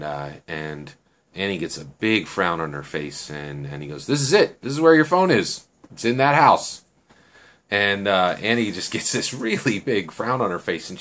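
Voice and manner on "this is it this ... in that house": higher, louder retold declaration; emphatic announcement. Man telling Annie where her phone is, emphatic. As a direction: announce it firmly as the quoted man.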